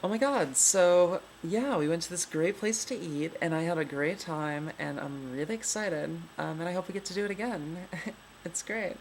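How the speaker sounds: excited feminine lilt, pitch swooping up; sing-songy woman. Shown as a demonstration. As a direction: gush in a sing-song female voice. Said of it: "And then women talk like".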